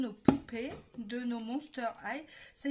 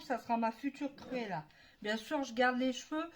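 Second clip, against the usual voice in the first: usual speaking voice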